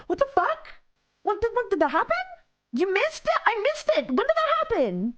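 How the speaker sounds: very high, incredulous questioning; stunned disbelief. Real, not acted. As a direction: reel from the shock of missing it. Shocked at having missed the donation.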